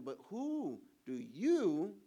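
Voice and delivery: "who" stretched slowly, rising; slow it right down on "who"; drawn-out question. Retold or imitated. Pastor voicing Jesus asking his disciples, slow stretched "who". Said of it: "He is asking them".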